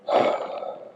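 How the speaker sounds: long unvoiced sigh; wistful sigh; sad sigh. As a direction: sigh out the sadness. Sigh before missing friend.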